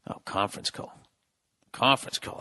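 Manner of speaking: low, soft, breathy aside; annoyed aside about his phone; quiet grumble. Real, not acted. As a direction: mutter it under your breath.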